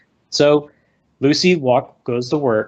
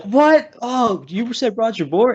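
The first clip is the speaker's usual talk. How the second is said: high, incredulous double take, slower on the second line; mock disbelief; 11 semitones higher, slower than usual. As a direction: play dumb in a much higher voice, as if you misheard. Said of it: "And then he's like".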